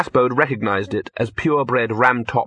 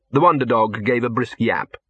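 plain reading voice

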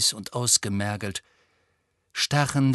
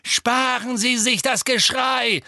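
high, raised, scornful call; shouted; 11 semitones higher, 4 dB louder than usual. A narrator's reading. Boy cuts off the witch's screams, shouting. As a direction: shout over her screaming. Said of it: "rief Harry".

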